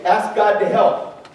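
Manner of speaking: ordinary talk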